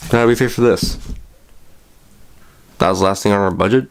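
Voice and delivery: ordinary delivery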